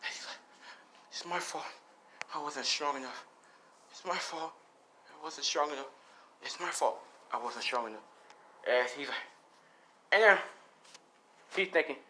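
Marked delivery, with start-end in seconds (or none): 1.1-8.1 mild acted crying. Son blaming himself as he limps off, mildly tearful. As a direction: repeat it softly, as if tearful. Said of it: "crying, limping".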